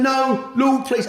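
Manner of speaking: high, loud, desperate plea; begging. Retold or imitated. To the Lord as he realises he's dead, high loud begging. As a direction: beg for your life. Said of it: "I was begging him".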